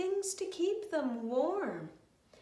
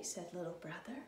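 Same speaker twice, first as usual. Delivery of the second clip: ordinary delivery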